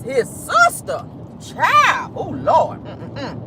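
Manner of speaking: high, slow shocked squeal. Real, not acted. Vlogger reacting to the Tinder sister twist, shocked. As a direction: gasp it out in disbelief.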